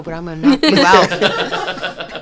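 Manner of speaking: put-on a kid's playful dare, laughing hard; laughing threat. Kid threatens mother, laughing.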